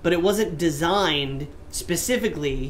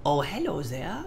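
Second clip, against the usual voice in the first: imitated friend; 3 semitones lower, 7 dB quieter than usual. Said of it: "a friend say".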